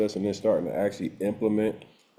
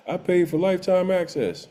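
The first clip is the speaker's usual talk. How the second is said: higher-pitched imitation of a complaining customer; mock indignant complaint; 8 semitones higher than usual. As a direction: mimic an entitled commenter protesting, pitched up. Mimics complaining customers in comments.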